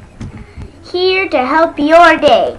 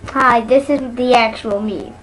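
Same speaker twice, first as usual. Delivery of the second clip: usual speaking voice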